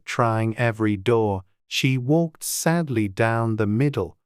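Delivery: usual speaking voice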